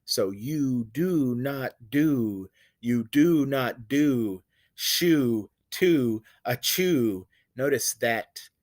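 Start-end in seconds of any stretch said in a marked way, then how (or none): 0.3-7.3 do, do, shoe and chew slowed and stretched on the ooh; slow repeat leaning on the ooh vowels; stressing ooh sound. Shown as a demonstration. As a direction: draw out every ooh very slowly. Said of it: "that ooh sound".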